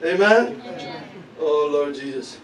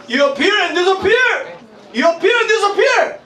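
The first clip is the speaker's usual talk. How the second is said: emphatic preaching; 14 semitones higher, 4 dB louder than usual. Acted. Preacher climax, rising pitch.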